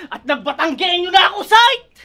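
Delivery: rising to a high, loud shout; shouted punchline. Acted. Rapper boasting of hometown to rival, shouted punchline. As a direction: build to a yelled last word. Battle rap punchline at full voice.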